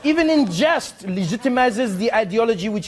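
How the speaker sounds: put-on indignant proclamation in French accent. Ambassador condemning the joke, indignant French accent. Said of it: "read it how I hope he wrote it".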